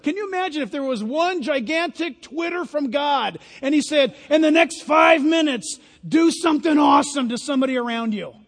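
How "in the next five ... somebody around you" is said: booming divine shout. Pretend. Preacher voicing God to the church, booming shout. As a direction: proclaim it like God's own voice. God's Twitter message, shouted.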